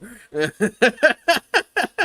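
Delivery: long high laughter; laughing. Real, not acted. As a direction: break up laughing. Laughs at the Kibbs quote.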